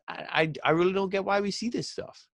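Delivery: high stammering exasperation; frustrated bafflement. Real, not acted. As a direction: throw up your hands in confusion. Frustrated rant about booking.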